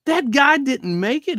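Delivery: voix haute et plus forte, incrédule; shocked disbelief. Retold or imitated. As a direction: ask it stunned, as if the news just hit. Retold shocked question to paramedic.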